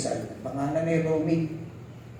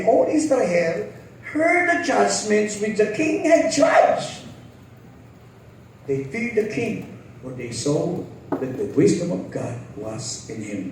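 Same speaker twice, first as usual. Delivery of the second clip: scripture reading; slower than usual. Acted. Reads scripture aloud.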